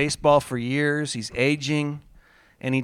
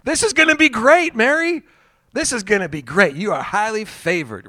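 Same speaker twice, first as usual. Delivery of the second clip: much higher, louder gushing cheer; Mister Positive; 7 semitones higher, 5 dB louder than usual. Pretend. Gabriel hyping Mary up, much higher and louder. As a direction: oversell it with beaming cheer. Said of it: "Mister Positive".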